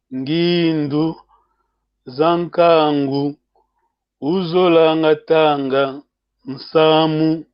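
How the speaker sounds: slow, word by word, with pauses between; slow repeat. Shown as a demonstration. Teacher rereads the Kikongo passage slowly for learners. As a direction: spell it out slowly so learners catch every word. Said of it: "je vais répéter, je vais répéter".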